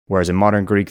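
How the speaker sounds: ordinary talk, nothing marked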